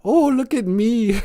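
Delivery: very high, slow, giggling look at me; high silly squeal with giggles; laughing show-off squeal. Pretend. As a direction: laugh through the preening. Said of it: "I say that a lot".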